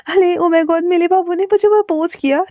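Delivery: a playful imitation of the girl; mimicking the girl. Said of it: "उस लड़की की तरह ऐसे ही उछलते हुए बोलता". (लड़की की नकल करते हुए हल्की ऊँची चीख)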